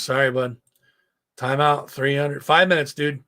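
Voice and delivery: ordinary talk, nothing marked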